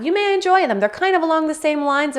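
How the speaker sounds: upbeat recommendation to viewers; enthusiastic. Real, not acted. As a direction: pitch it up with excitement.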